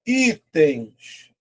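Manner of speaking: ITENS slowly split into i-tens; syllable by syllable. Shown as a demonstration. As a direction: say ITENS in two separate syllables. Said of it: "vou separar".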